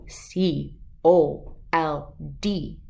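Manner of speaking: slow letter by letter; spelled out. Shown as a demonstration. Teaching a dog to spell, letter by letter slowly.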